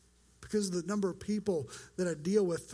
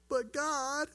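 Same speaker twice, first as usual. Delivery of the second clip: high, pouty whine; whiny pleading; 7 semitones higher than usual. Acted. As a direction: whine it upward like a sulking complainer. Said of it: "the most whiny voice he can muster up".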